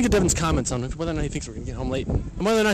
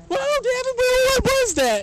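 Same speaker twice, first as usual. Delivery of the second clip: much higher, squeaky and drawn out; high-pitched mock panic; mock scared squeak; 5 dB louder than usual. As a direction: imitate yourself freaking out in a high squeal. Retelling own scared question.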